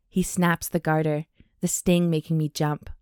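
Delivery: normal talking voice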